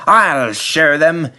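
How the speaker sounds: a proud engine vowing; slow, defiant self-promise; determined James. A narrator's reading. James resolves, to himself.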